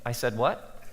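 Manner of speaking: weary self-doubting mutter; tired disbelief. Retold or imitated. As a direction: mutter it, drained. Tired pastor's hangover thought.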